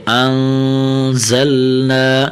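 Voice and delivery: slow انزلنا with the first N held as a hum; nasal ghunna. Shown as a demonstration. Teacher showing ghunna to pupils, انزلنا with a long nasal N. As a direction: hold a humming nasal N in انزلنا, chanted slowly. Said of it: "یہاں تھوڑا سا غنہ کرنا ہے".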